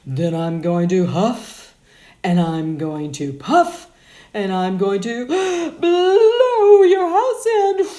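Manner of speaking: huff, puff, then rise into the blow; threatening wolf. Acted.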